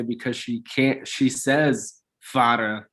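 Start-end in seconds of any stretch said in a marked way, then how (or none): 2.2-2.9 imitating a child's "Fada", TH lost to missing front teeth; lisped TH. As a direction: say "Fada" low, like a gap-toothed kid who can't make the TH. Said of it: "the T H".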